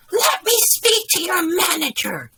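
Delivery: high, loud entitled voice; demanding mother. A narrator's reading. Mother demands the waiter's manager, high and loud.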